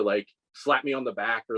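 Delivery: ordinary talk, nothing marked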